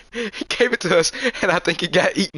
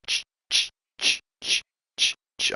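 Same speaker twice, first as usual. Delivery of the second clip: soft unvoiced crunching steps; quiet ch-ch rustle; footsteps in leaves; 5 dB quieter than usual. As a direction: imitate someone walking up from behind. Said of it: "I hear".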